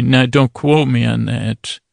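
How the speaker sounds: usual speaking voice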